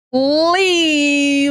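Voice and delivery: high drawn-out silly clown voice. Pretend. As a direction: stretch the word out like a goofy clown. Clownish stream sign-off.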